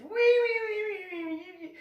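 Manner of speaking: high squealing whine, fading away; hammed-up squeaky fussing; mock huffy tantrum. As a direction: mimic someone whining in a huff. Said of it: "got all huffy puffy and mad".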